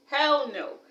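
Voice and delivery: imitated friends' loud, high "hell no"; emphatic denial. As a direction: belt out the friends' firm denial.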